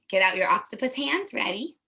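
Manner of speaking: usual speaking voice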